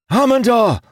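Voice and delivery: high, loud desperate call; cried out. Acted. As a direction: call his friend's name in alarm. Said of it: "Ilden cried".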